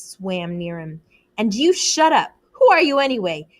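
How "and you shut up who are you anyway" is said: raised, contemptuous snap; angry retort. A narrator's reading. Jack snapping back at Ralph, angry and raised. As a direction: slap back at him.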